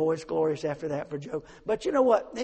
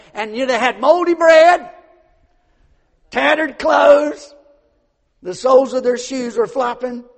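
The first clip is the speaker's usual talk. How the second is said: much louder, higher preaching; preaching at full force; 6 semitones higher, 12 dB louder than usual. Real, not acted. Preacher describing the Gibeonites' rags, shouting it out. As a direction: belt out the pitiful details.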